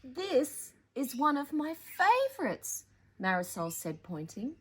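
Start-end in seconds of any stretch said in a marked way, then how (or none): 0.0-2.8 sweet sister. Acted. Sister admiring crumpled drawing.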